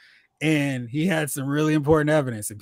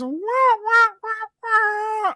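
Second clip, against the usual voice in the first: mock fanfare wail; much slower than usual. Real, not acted. Celebratory noise.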